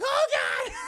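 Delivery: very high, loud mock horror; horrified gasp. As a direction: recoil in hammed-up horror. Kiko sees the monster.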